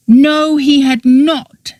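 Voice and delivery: a high, mild shout, slowing on the denial. A narrator's reading. Oberon losing his temper at Petra, shouting. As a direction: finally lose your temper. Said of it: "shouted Oberon, finally losing his temper".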